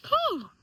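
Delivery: loud, high whew; high sharp exhale. Real, not acted. Speaker recovering after tearing up, high whew of relief. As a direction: blow out the emotion in one high burst.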